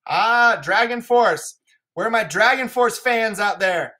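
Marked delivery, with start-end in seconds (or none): none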